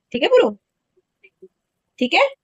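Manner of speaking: high, sing-song coaxing ठीक है; coaxing her son. Real, not acted. Mother coaxing her sulking son to agree, sing-song.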